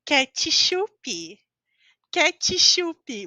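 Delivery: "ketchup" as KE-tchi-shu-pi, extra vowels added; "ketchup" Brazilian style, ending in pi. Shown as a demonstration. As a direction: sound out "ketchup" the Portuguese way with an I after T and P. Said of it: "a pronúncia dessa palavra é".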